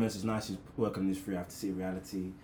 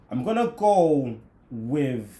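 put-on slow, drawling cowboy accent; fake Texan accent; 3 semitones higher, 8 dB louder, much slower than usual. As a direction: put on a Texas twang. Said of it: "came out of the weirdest American accent".

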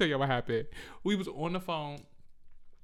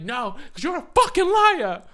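high, loud accusing yell; acted angry accusation; 14 semitones higher, 9 dB louder than usual. Woman re-enacting a fight with boyfriend, angry yell. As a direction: hurl it like mid-argument.

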